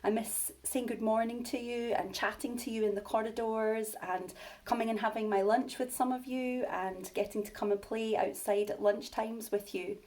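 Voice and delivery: ordinary delivery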